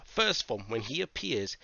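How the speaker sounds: ordinary delivery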